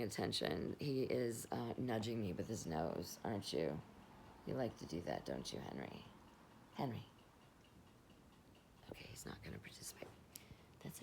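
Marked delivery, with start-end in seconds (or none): 1.5-7.0 lower, softer pet talk; fond voice for your pet; talking to the dog. Real, not acted. Talking to her dog Henry. 8.9-11.0 fast, breathless whispered aside; hushed quick aside. Real, not acted. Aside after dog ignores her.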